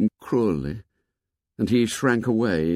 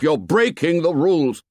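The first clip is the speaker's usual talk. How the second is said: high, raised-voice accusation; shouted; 6 semitones higher, 3 dB louder than usual. A narrator's reading. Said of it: "shouted Ralph".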